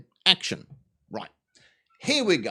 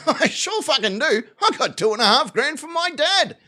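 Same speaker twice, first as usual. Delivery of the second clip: high-pitched, laughing mock enthusiasm; gleeful boast; 8 semitones higher than usual. Driver proudly tells the sheriff about his cash, excitedly. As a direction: blurt it out like an eager innocent.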